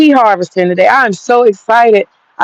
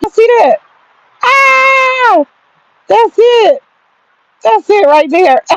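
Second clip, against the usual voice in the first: retold hollering; 13 semitones higher, slower than usual. Calling her husband to come see the seeds, excited high holler. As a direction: call someone over, pitched way up. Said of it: "I started hollering".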